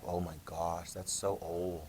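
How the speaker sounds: deflated mock gasp at old TV; mock dismay. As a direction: sigh it like a bored kid. Kid mocking old three-channel TV.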